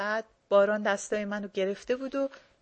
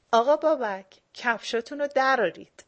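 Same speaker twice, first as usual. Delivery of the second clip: louder, said for someone else to overhear; pointedly loud; 3 semitones higher, 8 dB louder than usual. A narrator's reading. Ani telling Babak to remove shoes, loud on purpose. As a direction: make sure the host hears it. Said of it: "یه جوری که داشاکل قشنگ بشنوه گفت".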